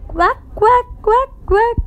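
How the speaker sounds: short, high repeated quacks; high duck imitation for children. Shown as a demonstration. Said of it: "Duck, what does do?".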